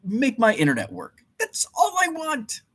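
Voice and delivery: high, whining, pleading voice; high mock-desperate whine; whiny rant. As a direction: beg like a fed-up child. Ranting plea about internet.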